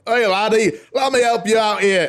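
high, exaggerated Scottish brogue; thick Scottish accent. Elvis offering the hero help, thick Scottish accent. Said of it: "Scottish accent".

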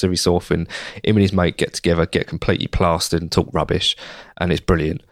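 ordinary talk

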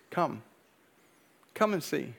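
soft, quiet, tender invitation; hushed gentle voice of God; God's gentle call. Retold or imitated. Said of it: "God just speaking to me".

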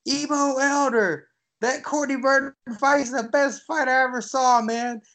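high, excited call from across the room; Sugar Ray Leonard yelling across the room. Retold or imitated. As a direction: shout his name across a hotel room. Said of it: "I heard a voice yell across the room".

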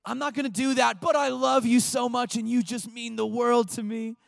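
loud, high-pitched gushing refusal; mock child protest. Child refusing homework from parents, hammed-up protest. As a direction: refuse and flatter at once, hammed-up.

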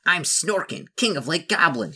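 high-pitched goblin king; Snorkin. Acted. Goblin king introduces himself to a newcomer, high goblin voice. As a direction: announce yourself as the goblin chief. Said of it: "he explains".

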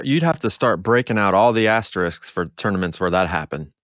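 ordinary delivery

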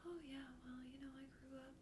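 breathy whispered imitation; soft-spoken whisper. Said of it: "he's just so soft-spoken normally".

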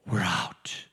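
breathless whisper. Retold or imitated. Escaper whispering relief after breaking free. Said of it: "You're like".

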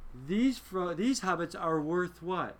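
slow, deliberate lines to the orcs; lightly voiced Boromir, slowed right down; Boromir's defiant message. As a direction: drag it out as if each word costs his life. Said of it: "He's telling the orcs".

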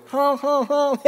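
high honking horn noises, then a laugh; mock car horn. Passing the pulled-over driver, taunting high horn honks. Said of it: "I slow down and go".

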